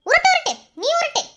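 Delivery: very high, loud jeer; shrill-pitched, angry mocking outburst; scornful disbelief. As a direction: jeer at an obvious lie. She mocks his excuse in quarrel.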